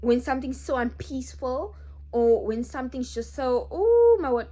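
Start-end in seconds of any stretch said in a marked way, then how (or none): 3.7-4.5 sudden high, loud cry of alarm; startled exclamation. Real, not acted. Vlogger interrupted by a fly, startled outcry. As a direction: jump at a fly buzzing past.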